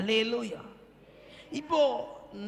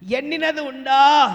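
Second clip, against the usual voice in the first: slow, booming, high-pitched question; loud challenge; 6 semitones higher, 17 dB louder, slower than usual. Shown as a demonstration. Preacher asking the congregation to speak up, loud challenge. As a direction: shout it to draw a louder answer. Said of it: "கொஞ்சம் சத்தமா சொல்லுங்க".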